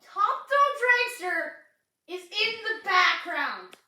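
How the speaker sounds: high, loud, slow exasperation; exasperated outburst. Real, not acted. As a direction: cry out in disbelief, slowly. Outburst at background coaster.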